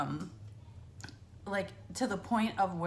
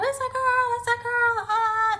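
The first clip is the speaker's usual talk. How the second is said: much higher and louder joyful shout; excited screaming; 8 dB louder than usual. Retold or imitated. Boys in the waiting room cheering for a sister, excited scream. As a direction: yell it like the happiest kid alive. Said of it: "screaming and freaking out".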